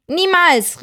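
very high, sharp refusal; shrill cry. Acted. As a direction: shriek it in protest. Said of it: "rief er schrill".

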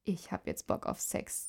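very soft whisper. A narrator's reading. Boy to his girlfriend among friends, whispering. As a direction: whisper it privately, just for one listener. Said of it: "Alex flüsterte Maria".

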